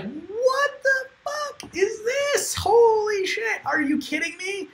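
high, slow shout of disbelief; shocked yelling. Retold or imitated. Recalling finding the flooded server room, shouted high in disbelief. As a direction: yell it like you can't believe your eyes.

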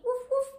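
high, loud woof woof like a small dog; playful dog bark. Acted. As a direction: bark it out for the children.

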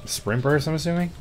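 ordinary talk, nothing marked

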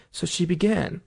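ordinary delivery, nothing marked

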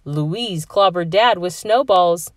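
ordinary delivery, nothing marked

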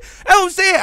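high, loud outburst; angry shout. Real, not acted. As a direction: shout the point out in frustration. Heated rant about double standard.

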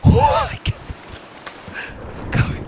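very high, squealed through laughter; helpless laughter. Real, not acted.

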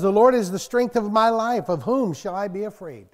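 loud, ringing scripture proclamation; preaching scripture. Acted. Preacher booming a psalm to the church, loud and declaiming. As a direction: proclaim it to the congregation.

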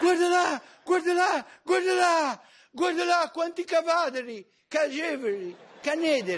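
shrill excited calling out; excited high shout. Acted. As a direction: point and shout, thrilled.

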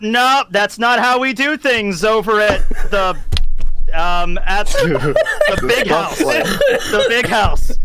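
slow, breaking into laughter on big house; can't hold the bluff together; laughing through it. Real, not acted. Player laughing mid-bluff about the big house.

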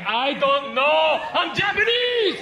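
high, loud, drawn-out mock yell; hammed-up exasperated shout. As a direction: yell it slowly, fed up with being asked. Exasperated man yells back.

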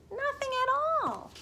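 put-on a comic peep for the kids; squeaky exclamation. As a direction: squeak it out in surprise.

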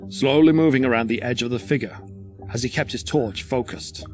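ordinary delivery

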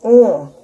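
long savoring hum; loud mm of delight. Real, not acted. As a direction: hum with pleasure at the taste. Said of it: "This is good".

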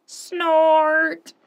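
loud snorting beast; booming monster snort; big snort. Acted. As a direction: snort like a huge machine. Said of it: "The big thing said".

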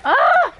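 loud high yelp of fright; short scream. Real, not acted. Gamer yelping at a sudden scare. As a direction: let out a sharp yelp.

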